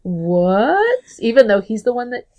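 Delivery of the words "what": mock-baffled double take, with rising questions; stunned reaction. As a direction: imitate a man caught off guard. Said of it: "Jim's reaction when she says".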